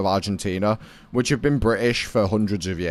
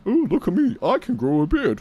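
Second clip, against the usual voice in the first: high, smug mimicry; mock him in a high, bragging voice; 11 semitones higher than usual. Mocking a friend's bragging.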